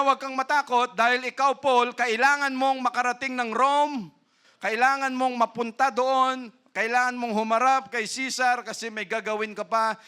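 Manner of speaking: preacher voicing God to Paul; fervent preaching. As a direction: press the promise on the congregation. Preaching God's words.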